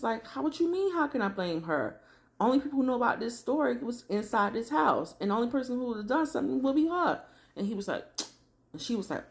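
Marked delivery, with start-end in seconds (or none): 0.3-7.2 high-pitched, imitated indignant accusation; retold angry retort. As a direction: fire back as the mad man, pitch up. Retold mad man accusing back.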